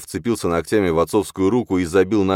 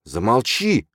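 higher-pitched angry retort; bristling; 7 semitones higher, 3 dB louder, slower than usual. A narrator's reading. Числав bristling at his stepmother's accusations, angry. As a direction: flare up and cut her off. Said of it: "ощетинился Числав".

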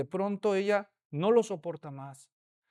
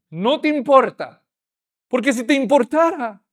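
manipulative accusation; 4 semitones higher, 10 dB louder than usual. Acted. Manipulative reproach.

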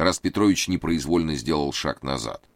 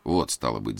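ordinary delivery

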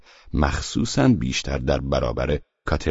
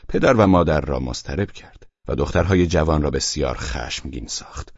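usual speaking voice

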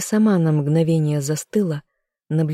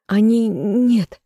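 desperate protest; 5 semitones higher, 4 dB louder, much slower than usual. A narrator's reading. Desperate refusal.

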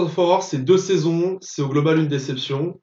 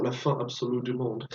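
put-on softer, lower, accented French; lightly put-on foreign accent in French; imitated foreign accent; 4 semitones lower, 9 dB quieter than usual. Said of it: "comme ils le disent avec l'accent".

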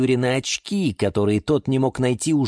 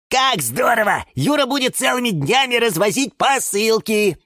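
high, joyful exclaiming; gleeful, pitched-up excitement; delighted; 13 semitones higher, 3 dB louder than usual. A narrator's reading. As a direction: rejoice at the news. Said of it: "обрадовались фикусы".

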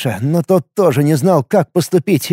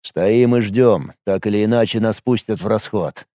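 gloomy bass voice; 7 semitones lower, 3 dB quieter than usual. A narrator's reading. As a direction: say it low and resigned. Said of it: "угрюмо пробасил Мареш".

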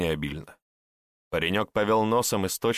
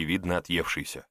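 usual speaking voice

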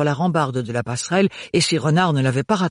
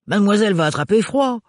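mock call over wind; 6 semitones higher, 3 dB louder, faster than usual. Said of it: "cria-t-il par-dessus le vent".

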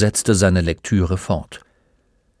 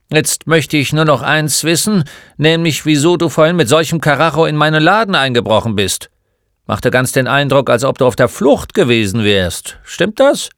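loud, higher-pitched interrogation; grumpy old shopkeeper; 5 semitones higher, 6 dB louder than usual. Acted. Old man questions the boy who burst into his shop, gruffly loud. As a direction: grill him like a crusty shop owner.

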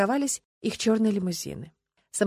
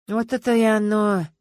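slow, musing tone; thoughtful; 4 semitones higher, 8 dB louder than usual. A narrator's reading. Detective musing on the motive, thoughtful and slow. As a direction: ponder it aloud. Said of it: "задумчиво сказал Стас".

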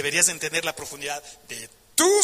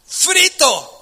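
emphatic repeat; 8 semitones higher, 5 dB louder than usual. Acted.

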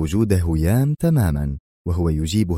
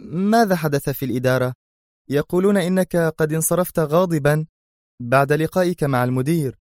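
higher-pitched voice for the female character; woman changing the subject; 8 semitones higher than usual. A narrator's reading. She asks him about the meeting to change the subject, in a high female character voice. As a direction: lift the pitch for her question. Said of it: "وهي تغمغم محاولة تغيير الحديث".

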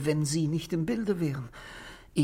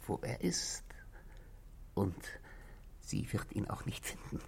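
low, unvoiced whisper; secretive whisper; 7 semitones lower, 11 dB quieter than usual. Husband tells the inspector where the gun is, whispering. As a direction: lean in and keep the secret. Secret about hidden revolver.

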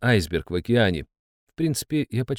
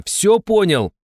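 shouting; 10 semitones higher, 5 dB louder, slower than usual. Acted. Hero shouts over singing.

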